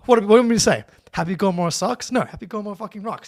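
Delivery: loud, fast, indignant; mock outrage. Real, not acted. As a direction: defend it with fake outrage. Mock-outraged defense of movie.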